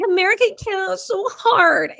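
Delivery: mock the people who say life is too hard; high mocking whiny voice; mocking whine. Mocking complainers' whining.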